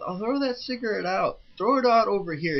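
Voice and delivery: pushy mock command, high-pitched; imitated urgent order. Friend ordering cigarette thrown out.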